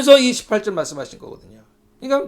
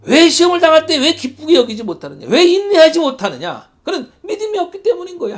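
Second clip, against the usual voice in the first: louder, higher, pressing the question home; preaching rebuke; 7 semitones higher, 7 dB louder than usual. Acted. Pastor pressing the congregation, rising rebuke. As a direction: demand an answer from the flock.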